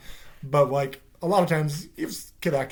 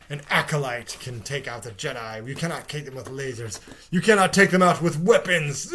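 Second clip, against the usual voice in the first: imitated ominous villain; 8 dB louder than usual. Reviewer imitating the man on the cliff, mock ominous. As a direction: declaim like a hammy villain, building.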